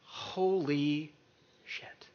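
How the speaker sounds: soft, drawn-out stunned swearing; retold stunned reaction. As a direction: let it sink out quietly in mock dread. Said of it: "I'm like".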